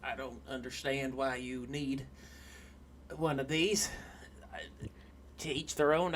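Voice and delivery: put-on soft, reluctant Southern-accented dwarf; hesitant and wary, in a hushed Southern accent; thick southern accent. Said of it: "he's got a thick southern accent".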